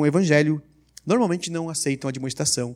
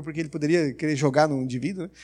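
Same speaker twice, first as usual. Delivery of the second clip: ordinary delivery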